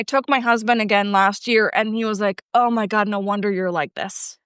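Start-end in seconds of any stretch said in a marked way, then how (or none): none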